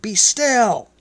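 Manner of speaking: loud, high command to the sea. A narrator's reading. Jesus commands the waves, loud and high. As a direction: still the storm with authority. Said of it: "He raised his hand over the waves and said".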